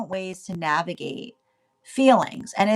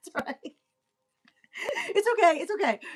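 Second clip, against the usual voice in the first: laughing, much higher; 11 semitones higher, faster than usual. Real, not acted. Reader reassuring listeners while laughing. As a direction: giggle through reassurance.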